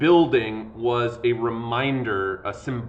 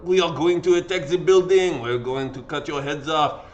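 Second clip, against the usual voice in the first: louder mock French accent, hammed up; bad French accent; 3 semitones higher, 4 dB louder than usual. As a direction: play a bloodthirsty French mob in a poor accent. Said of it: "I can't do a French, uh, accent".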